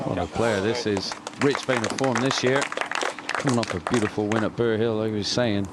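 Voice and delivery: ordinary talk, nothing marked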